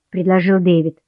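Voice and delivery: ordinary delivery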